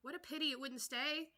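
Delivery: sighing. Acted. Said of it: "sighed the Lory".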